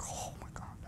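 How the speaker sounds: whispered mock gossip. Girls venting to friends in a whisper. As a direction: hush it like a secret between friends. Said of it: "It's like".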